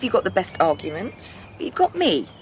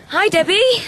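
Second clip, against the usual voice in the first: calling out; 12 semitones higher, 8 dB louder than usual. Acted. Greeting rider arriving at a distance.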